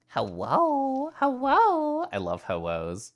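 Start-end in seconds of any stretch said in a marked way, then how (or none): 0.1-2.1 squeaky cartoon hello.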